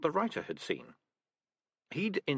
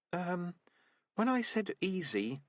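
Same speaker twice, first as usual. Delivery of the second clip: put-on higher, hesitant young rat; nervous young rat; 8 semitones higher, slower than usual. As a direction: squirm out of it. Nervous recruit Nourishing.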